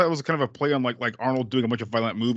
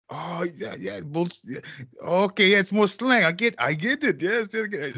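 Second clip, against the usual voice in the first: higher-pitched imitation, working it out; Arnold; 7 semitones higher than usual. Friend replays the star grasping slang, high imitated voice. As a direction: act out the slang slowly dawning on him, pitched up. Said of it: "He kinda says it quietly".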